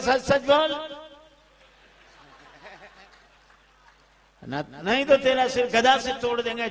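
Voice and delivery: high, forceful retold threat; loud threat. Angad threatens the impostor with his mace, loud threat.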